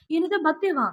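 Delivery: high-pitched surprised question. A narrator's reading. Surprised at hearing of birthday.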